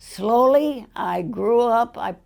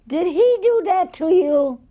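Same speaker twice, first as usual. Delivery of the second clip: lawyer voice; 9 semitones higher, 5 dB louder than usual. Retold or imitated. Lawyer quoted in retold story.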